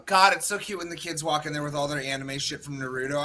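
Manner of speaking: normal talking voice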